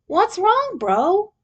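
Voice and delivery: a hint of a chill little brother; concerned younger brother asking what's wrong; Mikey's voice. A narrator's reading. Mikey voiced in fan story.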